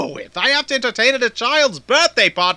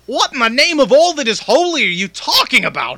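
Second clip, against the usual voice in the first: usual speaking voice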